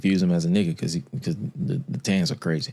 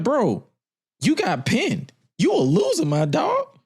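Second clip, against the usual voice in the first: high-pitched mocking taunt; incredulous, high-pitched ribbing; imagined taunt; 13 semitones higher than usual. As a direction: rub it in, voice pitched way up. Voices Randy taunting the loser.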